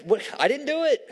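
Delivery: high, put-upon playful protest; a lightly hammed-up innocent protest; mock defensive denial. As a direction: play the caught-out kid denying it. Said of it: "I was like".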